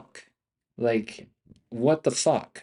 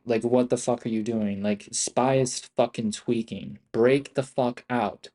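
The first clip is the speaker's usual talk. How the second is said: ordinary delivery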